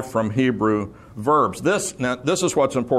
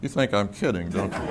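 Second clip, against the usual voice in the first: ordinary talk, nothing marked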